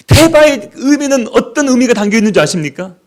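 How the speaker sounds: ordinary talk, nothing marked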